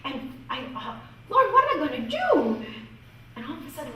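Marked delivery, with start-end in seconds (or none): 1.3-2.6 high, loud cry to heaven; exasperated plea. Retold or imitated. Teacher begging the Lord for help with a pupil, exasperated. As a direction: plead in mock desperation, pitched way up. Pleading with God about the pupil.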